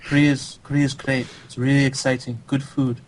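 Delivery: measured, accented player impression; Rhett. Host imitating an absent teammate chatting about Korea, accented. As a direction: speak carefully, as an imitated foreign gamer. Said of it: "wanna hear my Rhett impression".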